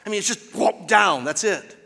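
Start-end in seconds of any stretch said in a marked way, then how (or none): 0.5-0.9 quick swallowing whoop; imitated gulp. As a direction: make a sudden gobble noise. Imitates alligator swallowing in one gulp.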